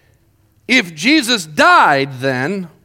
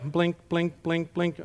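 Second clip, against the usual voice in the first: very soft, toneless little blinks; blank bafflement; 10 dB quieter, slower than usual. Acted. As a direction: drop to a small, baffled murmur. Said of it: "you kind of just like".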